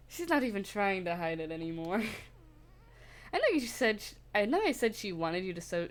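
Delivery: ordinary delivery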